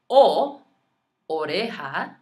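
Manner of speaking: ordinary delivery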